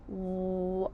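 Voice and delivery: "W-a-wa": held w, held ah, then blended; "W-a-wa" built up sound by sound. Shown as a demonstration. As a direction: stretch each sound of "W-a", then join as "wa". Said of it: "Sound wa".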